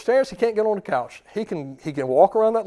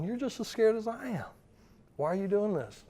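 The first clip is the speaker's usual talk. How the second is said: soft, slightly high voice for a worried dog; mild mock worry, hushed; scared dog; 5 semitones higher, 8 dB quieter than usual. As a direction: play a lightly voiced frightened pup. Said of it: "a dog that's scared".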